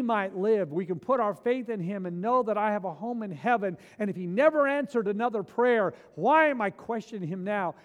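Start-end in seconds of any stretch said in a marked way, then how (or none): none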